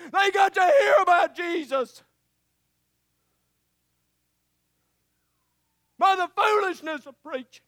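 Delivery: loud, high, shouting sermon delivery; preaching at full force. Acted. Preacher to his congregation, calling them to pray, preaching at full force. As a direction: drive it home to the congregation like a revival preacher.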